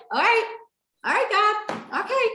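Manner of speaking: very high, squeaky self-talk; overwhelmed acceptance. Retold or imitated. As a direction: replay nervous psyching-up in a tiny high voice. Psyching herself up, overwhelmed.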